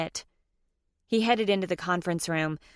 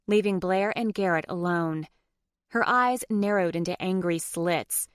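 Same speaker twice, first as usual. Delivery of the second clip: ordinary delivery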